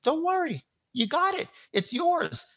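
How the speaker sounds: lightly reassure, high and gentle; imitating Trump. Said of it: "So he goes".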